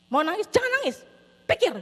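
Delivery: loud, high retold commands; exasperated, forceful parental bursts; stern scolding. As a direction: order a crying child to stop. Parent orders child not to cry.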